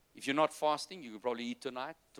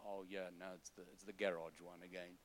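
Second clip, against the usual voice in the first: bored listener; 6 semitones lower, 14 dB quieter than usual. Retold or imitated. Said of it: "You go".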